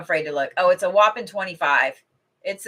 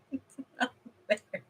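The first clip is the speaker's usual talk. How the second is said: soft mock whine; 6 semitones higher, 10 dB quieter than usual. Real, not acted. Mock complaint about cooking smell.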